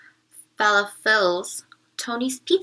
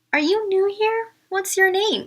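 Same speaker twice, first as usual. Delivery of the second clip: lightly voiced, higher curious girl asking; a girl's voice; 9 semitones higher, 5 dB louder than usual. A narrator's reading. Teacher reading a girl's eager question to the class.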